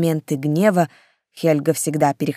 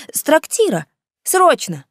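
put-on eager boy servant; 10 semitones higher, 3 dB louder than usual.

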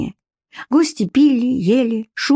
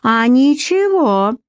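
voice raised proudly; 6 semitones higher, slower than usual. Acted. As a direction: lift the voice with pride. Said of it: "возвысила она голос".

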